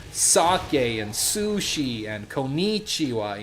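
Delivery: slow American stresses on "sake", "sushi" and "konnichiwa"; American-accented Japanese. Shown as a demonstration. Teacher showing learners what to avoid, American misplaced emphasis. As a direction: lean hard on one vowel per word, American-style. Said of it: "if you keep going".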